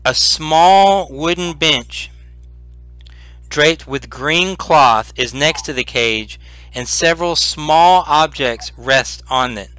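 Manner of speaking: slow, measured read-aloud description; game master's scene setting. A narrator's reading. Game master describing the ruined chamber to players, slow and measured. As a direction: paint the room for the players slowly.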